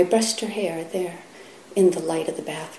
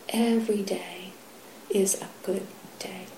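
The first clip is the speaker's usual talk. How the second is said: hushed quote; 9 dB quieter than usual. Retold or imitated. Teacher closing the talk with Yunmen's words, whispered. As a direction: hand them the master's words in a whisper. Quoting Yunmen at talk's close.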